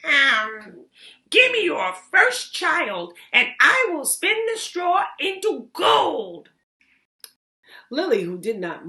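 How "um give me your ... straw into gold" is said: put-on high, loud goblin voice, slow on first child; the goblin springs his wicked price; scheming goblin. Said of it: "and replied".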